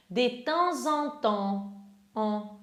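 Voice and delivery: de temps en temps slow, stopping on the nasal en; nasal ending shown. Shown as a demonstration. Teacher shows the nasal en in de temps en temps. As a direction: end de temps en temps on the nasal en, no P sound. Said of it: "Eu paro no som nasal".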